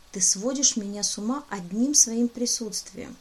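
ordinary delivery

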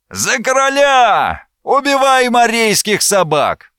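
high, drawn-out war cry; warriors' battle roar. A narrator's reading. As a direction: cry it out slowly, charging into battle. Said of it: "рёва вражеских воинов". (протяжный боевой клич высоким голосом)

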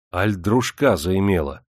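ordinary delivery

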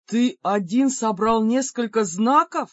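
slow, loud demon voice; astonished demon. Acted. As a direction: marvel at it, booming.

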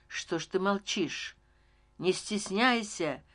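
ordinary delivery, nothing marked

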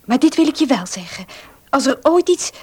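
normal talking voice

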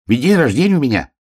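высокий беглый заячий голосок; пробормочи тоненько и бегло; бормочущий заяц. A narrator's reading. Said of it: "бормотал заяц". (заяц тараторит под нос)

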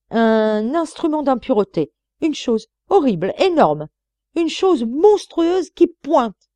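loud, high, halting, slowing on the monstrous thing; nun scandalized telling the dean; agitated outburst. Acted. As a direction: stumble then blurt the unspeakable. Nun flustered by indecency.

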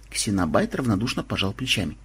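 plain reading voice

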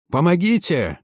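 high, urgent call at moderate volume; cry for help. A narrator's reading. Mechanic struggling with jammed hatch, crying out. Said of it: "кричал кто-то".

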